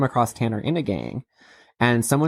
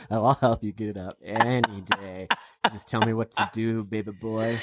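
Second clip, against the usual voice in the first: quieter, teasing come-on; mock flirty; 2 semitones lower, 4 dB quieter than usual. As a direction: lay it on thick, playfully. Mock flirt after clip.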